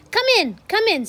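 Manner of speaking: Grand High Witch snapping at her nervous guests; snapped. Acted. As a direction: bark it at the dithering witches. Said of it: "snapped the Grand High Witch".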